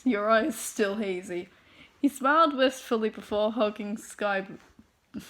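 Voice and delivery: ordinary delivery, nothing marked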